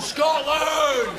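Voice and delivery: very high, strained battle cry; impassioned yelled chant; defiant shout. Acted. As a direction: scream it like a patriot.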